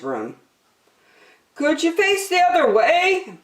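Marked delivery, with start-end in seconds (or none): 1.6-3.4 high, politely asking; polite Percy. Acted. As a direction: ask it politely but squashed. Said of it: "Percy asked the squirrel".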